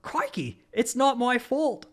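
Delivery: high, hammed-up Australian accent. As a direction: put on a big, drawn-out Aussie accent. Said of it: "gonna try this in a very Australian accent".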